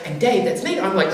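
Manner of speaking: usual speaking voice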